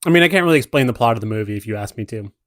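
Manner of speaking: ordinary talk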